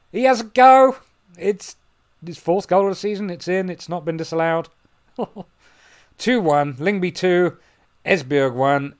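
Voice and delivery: high, loud, thrilled commentary; excited goal call. Real, not acted. Goal scored in match commentary.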